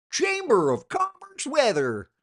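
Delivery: slow, high, loud sneering repeat of Chamber of Commerce weather; drawn-out mockery. Repeating the hated phrase to viewers, slow and mocking. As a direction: stretch out the cliché with disdain. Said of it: "It's like".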